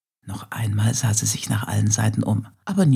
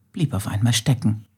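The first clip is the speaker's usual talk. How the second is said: plain reading voice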